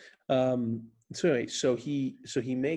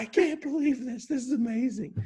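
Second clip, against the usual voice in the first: high, bubbling delight; overwhelmed, can't believe it; shaking excitement; 12 semitones higher, 4 dB louder than usual. Retold or imitated. Drunk friend after his albatross, high and overjoyed.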